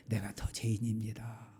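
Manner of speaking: hushed whisper of guilt; whispered confession. Acted. Pastor confessing to the congregation, soft whisper. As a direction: breathe it out as a confession.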